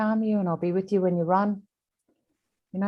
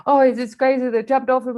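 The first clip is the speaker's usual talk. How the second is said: higher, louder retold alarm; worked-up trainer; 6 semitones higher, 6 dB louder than usual. Trainer explaining the jockey jumped off, loudly excited. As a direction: blurt it out like the trainer did.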